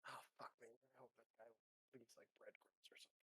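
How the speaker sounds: very low, hushed grumble; muttering to oneself. Acted. Captain privately grumbling about following the trail, muttered to himself. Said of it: "You hear me muttering this to myself".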